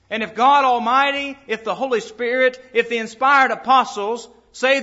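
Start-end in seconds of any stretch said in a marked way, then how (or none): none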